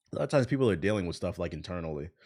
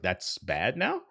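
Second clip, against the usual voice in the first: sarcastic; much slower than usual. Retold or imitated. Sarcastic reply to online critic.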